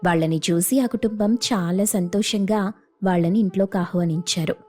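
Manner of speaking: usual speaking voice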